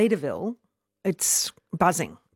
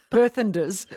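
laughing; 5 semitones higher, slower than usual. Real, not acted. Joking new word, laughing.

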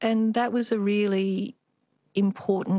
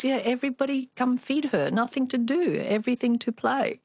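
put-on Chinese accent; 6 semitones higher than usual. Mum quoted in accent.

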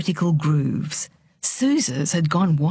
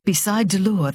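usual speaking voice

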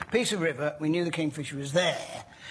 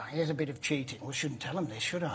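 conspiratorial hush; 3 semitones lower, 7 dB quieter, much faster than usual. Real, not acted. Confessing a sneaky trick.

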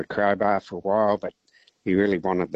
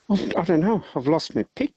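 high-pitched, tearful mock wail; whimpering, hammed-up confession; mock crying; 9 semitones higher than usual. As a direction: play a distraught teddy bear, voice shooting up. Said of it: "And he's crying, ... he's in hysterics".

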